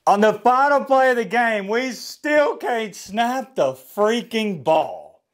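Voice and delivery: high exasperated outburst, then slowed; calm turning angry. Real, not acted. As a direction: burst out high, then drag it slowly. Ranting about the bad snap.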